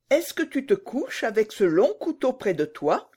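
put-on high little-girl voice, uneasy question; anxious little Gerda. Gerda eyeing the robber girl's knife, high and uneasy. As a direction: ask it high, like a worried child.

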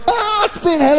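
very high mock shriek for help; hammed-up helpless woman; fake spider panic. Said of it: "is van". (gespeelde paniek om een spin)

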